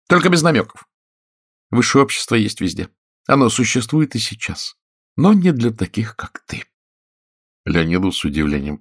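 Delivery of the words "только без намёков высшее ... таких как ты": high, cutting woman's voice, dropping at the end; haughty actress. A narrator's reading. Actress tells Oleg high society excludes him, disdainful. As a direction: put him down as the offended lady.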